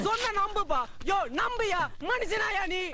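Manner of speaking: high, urgent pleading. Acted. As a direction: beg him to believe you. Son pleads with father.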